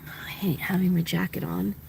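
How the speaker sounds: soft breathy whisper. Real, not acted. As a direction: whisper it to yourself. Whispering in the truck.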